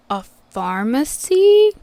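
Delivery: slow, drawn-out mock disbelief at an obvious question; sarcastic. Vet worker retelling her answer to a clueless client, slow and sarcastic. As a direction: spell out the obvious, slowly.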